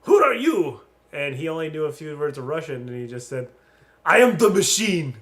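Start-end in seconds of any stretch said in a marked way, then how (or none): 0.0-0.8 loud, high mock-Russian challenge; imitated Russian-accented broken English, raised voice. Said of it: "they say in broken English". 4.0-5.2 high, booming mock declaration; hammed-up shout, pitched up; imitated loud boast. Comedian's boast to mobsters, retold.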